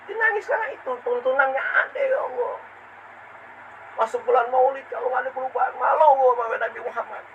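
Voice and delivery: high, plaintive preacher's voice; impassioned preaching. Acted. Preacher shamed before the Prophet, plaintive and impassioned. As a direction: lament it as if near weeping.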